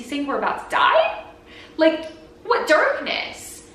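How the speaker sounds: slow, alarmed disbelief on darkness; mock panic. Girl panics to her friend on the flight, mock alarmed. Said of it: "I was like".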